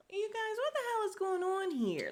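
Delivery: very high, baffled outburst; incredulous. Real, not acted. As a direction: squeal it to your viewers in disbelief. Baffled by his renewed crying.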